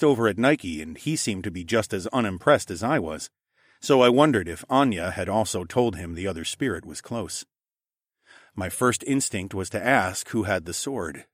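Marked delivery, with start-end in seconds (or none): none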